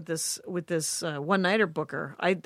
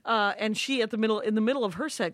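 ordinary delivery

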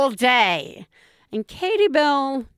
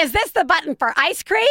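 put-on high, whiny child voice; 7 semitones higher, faster than usual. Child pushing parent's buttons.

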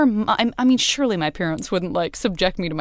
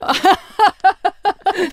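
big laugh; 12 semitones higher, 5 dB louder than usual. Real, not acted. Laughing at the story.

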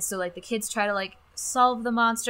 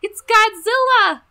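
high, loud cheering cartoon kid voice, slowing on "It's Godzilla"; excited cartoon kids; 12 dB louder than usual. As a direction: shout it like thrilled kids spotting the monster. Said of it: "the kids going just like".

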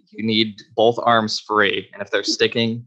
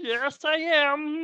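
very high, slow frog-puppet voice; Kermit the Frog; 5 dB quieter, slower than usual. Acted. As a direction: answer high and drawn out as the puppet. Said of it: "are you Kermit the Frog".

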